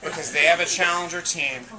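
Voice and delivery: low, measured introduction; dramatic announcer. Acted. Host introducing the challenger team, dramatic announcer.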